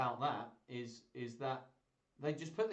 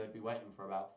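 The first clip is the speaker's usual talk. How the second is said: ordinary talk, nothing marked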